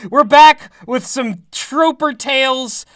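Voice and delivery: ordinary talk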